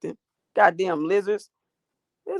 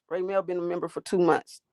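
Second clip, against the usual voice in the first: normal talking voice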